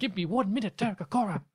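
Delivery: exasperated spirit guide, pitched high; put-on accent, pestered and protesting; Sam. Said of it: "You should do a Sam impression".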